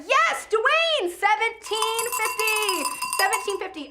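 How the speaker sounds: high, loud cheer with stretched numbers; excited shout. Real, not acted. Auctioneer gets the bid she wanted, thrilled shout.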